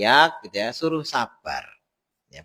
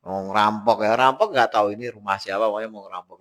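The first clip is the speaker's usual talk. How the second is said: normal talking voice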